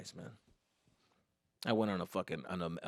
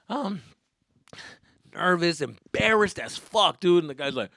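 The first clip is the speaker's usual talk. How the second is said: ordinary delivery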